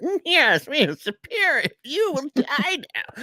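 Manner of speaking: hammed-up superior god voice; pompous villain impression. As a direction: lord it over them with lofty mock grandeur. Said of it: "how he read it".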